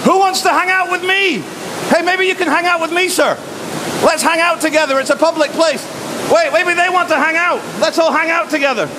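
very high, piercing falsetto calling out; high-pitched character. Pretend. Calling to men at a public hangout, high mock-friendly falsetto. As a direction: put on a squeaky high voice, hailing strangers.

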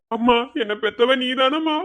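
ordinary talk, nothing marked

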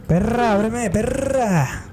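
high-pitched playful demand to open up; mock demand. (exigencia en broma, voz aguda)

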